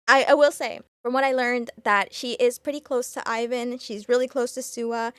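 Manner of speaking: ordinary delivery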